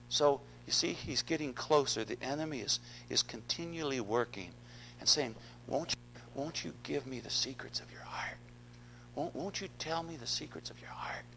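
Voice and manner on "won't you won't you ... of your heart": soft, softer whispered coaxing; seductive whisper. Acted. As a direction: whisper it as a tempting secret. Enemy whispering temptation to Samson.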